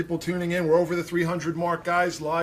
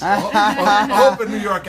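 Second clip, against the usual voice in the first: laughing; 6 semitones higher, 11 dB louder than usual. Real, not acted. Laughing at accent comment.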